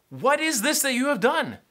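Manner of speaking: loud, high, alarmed cry of the sailors; alarmed shout. Acted. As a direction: shout it at the runaway prophet in fright. Frightened sailors confront Jonah.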